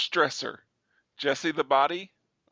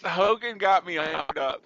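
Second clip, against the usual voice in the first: ordinary delivery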